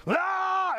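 long high yelling "ah"; imitated demoniac scream; high-pitched wail of mock frenzy. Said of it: "the guy starts yelling again".

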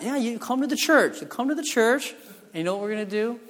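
loud, high, eager sales pitch. Retold or imitated. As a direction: sell her on the plan, loud and pumped. Pastor eagerly pitches the lockdown plan.

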